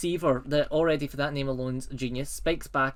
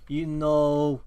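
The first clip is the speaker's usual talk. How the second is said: lightly voiced fatherly wolf; slow down slightly into a wolf dad's counsel; wolf father figure; 3 semitones lower, 3 dB louder than usual. Movie wolf advising his pup, lightly voiced. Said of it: "the wolf's like".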